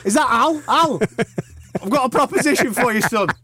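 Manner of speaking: high, cheeky wheeler-dealer voice; Eddie Hearn impression. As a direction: play the sly Essex promoter. Imitating Eddie on phone.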